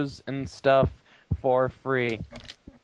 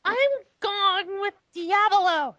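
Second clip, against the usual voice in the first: very high, slow falsetto chirp; answered happily; 4 dB louder, slower than usual. Acted. Reading Willow's happy answer in a hammed-up falsetto. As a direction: chirp it out in a silly happy squeak. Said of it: "she answered happily".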